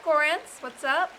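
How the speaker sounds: ordinary delivery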